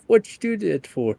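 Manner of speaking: high, fast indignant yelp; startled dwarf. Retold or imitated. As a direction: squeak it out, offended. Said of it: "He's like".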